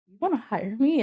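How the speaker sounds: high-pitched, astonished question; incredulous. Retold or imitated. Applicant retelling the call offering her the job, incredulous. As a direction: replay your disbelief at being chosen.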